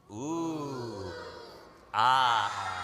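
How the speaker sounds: big shouted ah after the ooh; ooh ah with feeling. Shown as a demonstration. Teacher asks crowd for the ooh ah with feeling. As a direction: pour real feeling into the awe. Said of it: "One more time with feeling".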